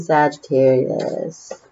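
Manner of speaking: ordinary delivery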